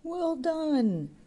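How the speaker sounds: high cheer of approval. A narrator's reading. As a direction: cheer him from the bar. Drinkers cheering.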